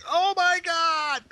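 high, drawn-out squeal; mock horror. Joking about biting into a disguised creature, high mock-horrified squeal.

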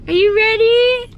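high, bright call to the puppy; high excited voice asking the puppy if he's ready. Real, not acted. Hyping puppy before car wash.

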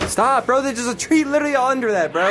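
loud annoyed shout; exasperated shout. Real, not acted. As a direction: yell at a jumpy friend. Friend yelling him down.

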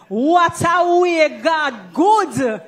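slow, high Jamaican Patois exclamation; Jamaican phrase. Shown as a demonstration. Host teaching overseas guests, in slow Jamaican Patois. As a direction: say it the Jamaican way, drawn out and marvelling. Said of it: "Let me hear you try it".

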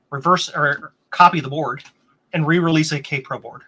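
ordinary talk

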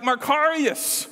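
high, drawn-out cheer of praise; hearty congratulations. Acted. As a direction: celebrate someone's big success. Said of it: "when someone did really great".

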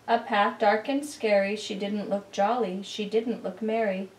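usual speaking voice

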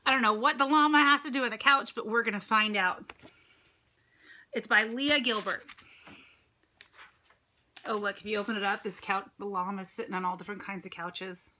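plain reading voice

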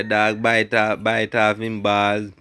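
ordinary delivery

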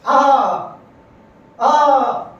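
sharp loud cry of pain at each axe blow. Acted. Tree struck by the axe again. (કુહાડીના ઘાથી દુખાવાની ચીસ)

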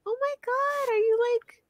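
high-pitched gasp of alarm at the dog; surprised high squeal. Retold or imitated. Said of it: "I was like".

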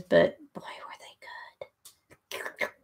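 slow indulgent whisper; drawn-out whispered delight. Real, not acted. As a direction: breathe it out slowly, savoring. Savoring memory of cookies.

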